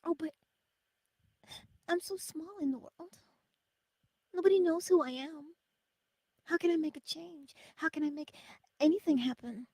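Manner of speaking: high, pleading imitation of a doubter; hammed-up helpless self-pity; mock small whiny voice. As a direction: whine like someone who feels too small. Mocking a self-doubting viewer.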